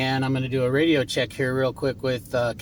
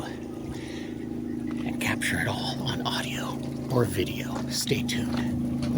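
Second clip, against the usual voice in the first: whispering; 6 semitones lower, 4 dB quieter, much slower than usual. Acted. Hushed sign-off while hunting Bigfoot.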